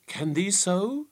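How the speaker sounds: doubtful. A narrator's reading. Fool doubting the lass can read riddles, slow and doubtful. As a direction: sound unconvinced. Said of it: "doubtful like".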